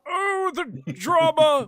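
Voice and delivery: loud, high theatrical lament; hammed-up operatic outcry; Alex Louis Armstrong. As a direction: drag it out in melodramatic anguish. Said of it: "Alex Louis Armstrong".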